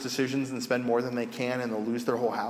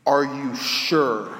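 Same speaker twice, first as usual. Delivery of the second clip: skeptical; 5 dB louder, much slower than usual. Retold or imitated. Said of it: "looks at me very skeptically".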